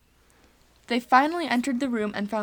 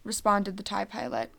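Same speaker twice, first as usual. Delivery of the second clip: usual speaking voice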